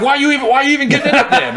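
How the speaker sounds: fast, high mock yell; imitated yelling. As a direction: rush it out like a worked-up boss. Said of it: "So he's, like, yelling".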